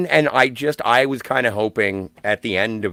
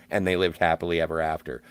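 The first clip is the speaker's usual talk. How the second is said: fairy-tale ending; 7 semitones lower, 5 dB quieter, faster than usual. Shown as a demonstration. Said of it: "the end of that movie should be".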